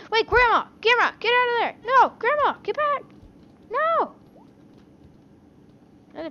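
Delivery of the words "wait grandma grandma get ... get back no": high frantic yelling for grandma; panicked calling. Acted. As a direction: scream after her in a panic.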